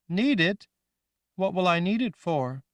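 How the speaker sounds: put-on high, loud young girl voice; childlike Rachel. Girl Rachel asking the woman, high and loud. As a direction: sound like a scared little girl.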